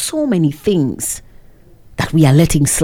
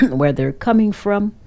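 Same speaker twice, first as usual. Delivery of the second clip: usual speaking voice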